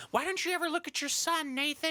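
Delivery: high, shrill-pitched nagging mother; mock nagging mom. Mother scolding dad in background, high nagging. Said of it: "And they're like".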